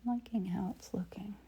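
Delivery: soft whisper to herself. Real, not acted. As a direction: whisper as if painting alone. Whispered painting narration.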